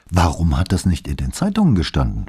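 ordinary delivery, nothing marked

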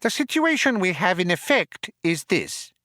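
high, squeaky, businesslike mouse; tiny mouse explaining the situation. A narrator's reading.

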